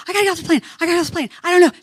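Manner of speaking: high, urgent mock pleading; retold panic. Passenger begging attendant to leave, high mock panic.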